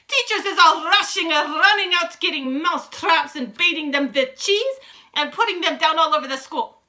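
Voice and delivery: high, loud witch tirade; excited gloating witch. A narrator's reading. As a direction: crow over the coming chaos. Witch continues her shouted speech.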